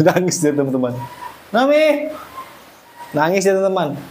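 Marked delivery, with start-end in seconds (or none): 1.5-2.1 high call of the name. Real, not acted. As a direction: call the little one by name. Calling the crying baby animal. (memanggil nama dengan nada tinggi)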